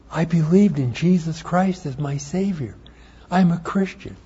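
blurting out the news at last; eager confession. Retold or imitated. Confessing faith to mom at breakfast.

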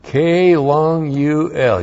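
K long U L spelled slowly; spelling out. Shown as a demonstration. Teacher spelling the Hebrew verb for the congregation, slow. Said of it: "You thought it was C O O L".

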